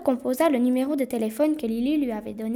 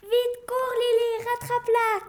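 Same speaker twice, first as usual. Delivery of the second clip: urgent high-pitched cry; boy shouting; 12 semitones higher, slower than usual. Acted. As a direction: yell after the runaway sled. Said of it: "cria Tim à sa sœur".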